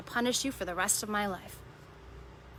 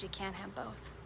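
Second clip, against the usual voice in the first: much softer, tender correction; God gently telling the preacher no; gentle and loving; 13 dB quieter than usual. Retold or imitated. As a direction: say it like a kind father easing a child. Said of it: "God so gently and kindly and lovingly goes".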